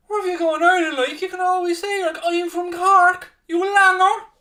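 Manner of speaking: very high, sing-song Cork accent; hammed-up Cork accent impression. Shown as a demonstration. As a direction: put on an exaggerated squeaky Cork voice. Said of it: "that's my best Cork accent".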